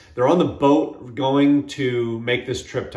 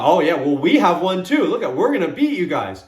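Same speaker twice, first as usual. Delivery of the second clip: louder, higher cocky comeback; arrogant retort; 4 semitones higher, 5 dB louder than usual. Retold or imitated. Brother boasting at the rival team, loud and cocky. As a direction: puff up and brag back at them. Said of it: "the arrogance wanted to come out".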